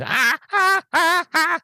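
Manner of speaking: very high staccato screeches; mock burning screams. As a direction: imitate a vampire shrieking as he fries. Imitates vampire being barbecued.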